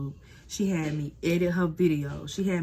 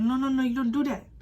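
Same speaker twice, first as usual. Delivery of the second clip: scolding boss; 8 semitones higher, 4 dB louder than usual. Retold or imitated. Boss scolding, voiced high.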